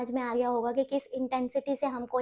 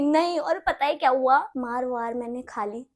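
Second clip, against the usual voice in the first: ordinary delivery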